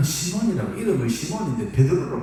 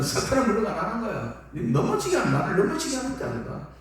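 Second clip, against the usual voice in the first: stern rebuke; 7 semitones higher than usual. Acted. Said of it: "그러니까 예수님은".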